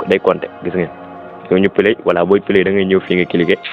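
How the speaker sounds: usual speaking voice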